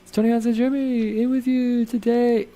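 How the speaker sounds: put-on podcast host voice; a different voice for the intro; changed intro voice. Shown as a demonstration. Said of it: "I just gotta change the voice".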